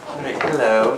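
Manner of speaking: ordinary talk, nothing marked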